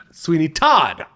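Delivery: "Todd" punched in "Sweeney Todd"; stress on Todd. Shown as a demonstration. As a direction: hit "Todd" hard. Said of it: "The emphasis on Todd".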